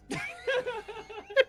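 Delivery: high-pitched helpless laugh. Real, not acted. Player reacting to a bad roll, high laugh.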